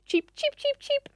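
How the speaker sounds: short high chirps, a calmer small bird; relaxed chickadee chirps, high; bird impersonation. Retold or imitated. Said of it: "it might just go like".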